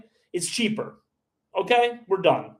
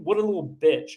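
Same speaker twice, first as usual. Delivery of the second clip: ordinary talk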